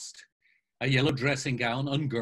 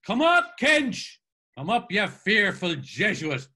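much higher and louder, calling down the stairs; 7 semitones higher, 8 dB louder, slower than usual. A narrator's reading. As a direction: shout it down the dark stairwell. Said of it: "called out hoarsely".

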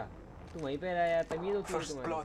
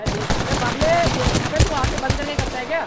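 loud, higher frustrated yell; frustrated shout; 4 semitones higher, 9 dB louder than usual. Real, not acted. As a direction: yell at a careless teammate. Frustrated at teammate.

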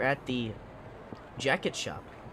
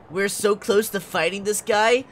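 excited; 5 semitones higher, 9 dB louder than usual. Real, not acted. Eager gamer near the boss fight.

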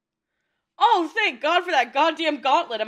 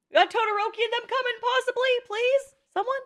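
high, hurried plea trailing into questions; anxious pleading; 7 semitones higher, faster than usual. Real, not acted. Viewer begging for the other boys to come, anxious pleading. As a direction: beg hopefully for backup.

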